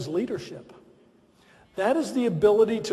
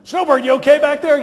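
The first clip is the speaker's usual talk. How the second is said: loud, higher call over the shoulder; 1 calling back; 6 semitones higher, 9 dB louder than usual. Retold or imitated. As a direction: shout back down the trail. President calling back after crash.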